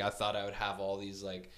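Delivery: ordinary delivery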